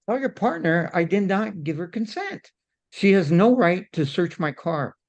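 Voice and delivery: firmly demanding his rights; escalated. Retold or imitated. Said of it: "like the way I'm talking now".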